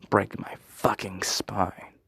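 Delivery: normal talking voice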